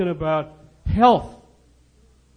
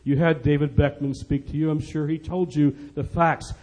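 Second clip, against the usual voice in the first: usual speaking voice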